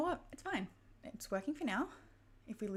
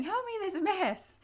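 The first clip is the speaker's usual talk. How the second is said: high, urgent little-kid voice, imitated; imitating her son; 12 semitones higher, 7 dB louder than usual. Son runs downstairs to report the spill, high mock-childish plea. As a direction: mimic a child pleading for help. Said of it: "he comes running down the stairs saying".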